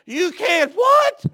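slow, high, loud shriek of a question; horrified disbelief. Retold or imitated. Preacher shrieking at the pilot, slow high horrified question. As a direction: stretch it out in laughing terror. Preacher hears landing gear stuck.

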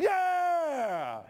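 high, loud joyful shout; spirit shouting yeah. Real, not acted. Preacher fired up shouting his spirit's joyful yeah. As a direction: let it burst out fired up. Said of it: "My spirit's like".

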